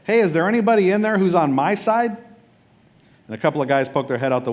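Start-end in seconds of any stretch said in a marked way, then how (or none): 0.0-2.2 loud, high call up to a window; retold yell up at a palace window. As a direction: shout up to the people inside. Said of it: "He just yells up into her window".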